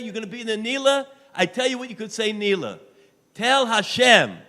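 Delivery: loud, high fervent preaching; loud holiday sermon plea; preaching at full force. Real, not acted. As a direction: drive the congregation to give.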